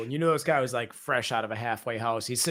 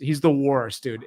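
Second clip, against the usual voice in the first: normal talking voice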